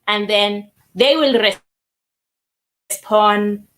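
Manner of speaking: ordinary talk, nothing marked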